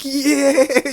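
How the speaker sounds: high, loud mock cheer; imitated crowd cheer. Comic imitating a crowd going crazy, high excited cheer. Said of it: "everybody just go crazy".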